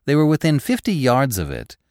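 ordinary delivery, nothing marked

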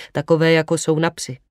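plain reading voice

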